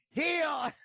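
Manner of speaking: high mock faith-healer's command; Ernest Angley impression. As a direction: cry it out like a preacher working a miracle. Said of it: "He'd go".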